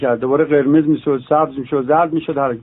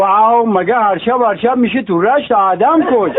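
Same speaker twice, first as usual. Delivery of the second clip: put-on Gilaki accent; 4 semitones higher than usual. Rashti man answers friend.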